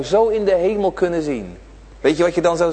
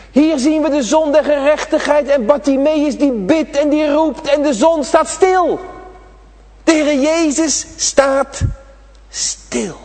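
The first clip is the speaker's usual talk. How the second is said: raised, ringing sermon voice; fervent preaching; 10 semitones higher, 4 dB louder, slower than usual. Acted. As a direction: preach it out to the children, high and carried. Preaching Bartimaeus scene.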